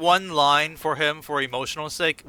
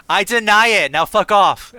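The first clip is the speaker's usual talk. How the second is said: louder, higher gruff brush-off; mock brusque Baraka; 5 semitones higher, 7 dB louder, slower than usual. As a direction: wave him away like a rude warlord. Voicing Baraka's dismissal.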